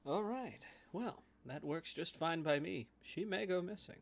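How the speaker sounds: soft, discreet scheming; voice kept low. Acted. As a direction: keep it down so she won't hear. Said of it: "he's keeping his voice low".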